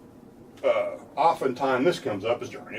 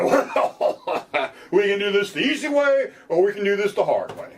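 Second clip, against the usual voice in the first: mock cop threat; 7 semitones higher than usual. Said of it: "they may reply".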